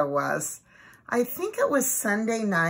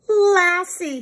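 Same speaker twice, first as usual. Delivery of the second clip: put-on proud boy calling; 11 semitones higher, 6 dB louder than usual. A narrator's reading. Timmy pleased and proud.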